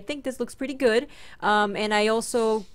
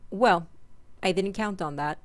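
usual speaking voice

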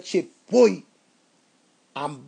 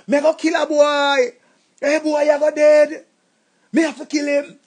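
raging threat; 5 semitones higher, 4 dB louder than usual. Acted. Jilted man raging about the new boyfriend, shouting threats.

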